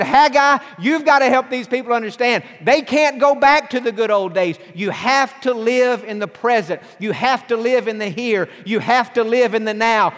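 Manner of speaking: full-force sermon peak; preaching at full force. Acted. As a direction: drive it home to the congregation. Preaching God's words.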